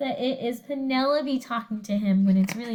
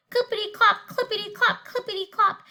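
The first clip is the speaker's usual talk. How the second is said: higher, singsong clippity-clop; bouncy hoofbeats; 6 semitones higher than usual. Acted. Princess following the prince, bouncy higher hoofbeats. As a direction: chant the hooves playfully. Hoof sounds in story.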